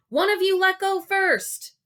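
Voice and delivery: high, loud instruction; shouting. Acted. Dale telling the boys to let go, loud shout. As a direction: shout the plan across. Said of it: "Dale shouted".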